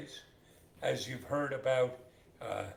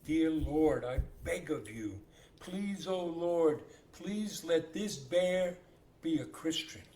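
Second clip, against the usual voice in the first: lightly acted begging, slow and raised; pleading prayer; 5 semitones higher, 5 dB louder, slower than usual. Man chased by a bear prays to be spared, mildly pleading. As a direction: draw out a mock-earnest plea to God. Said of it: "He starts praying".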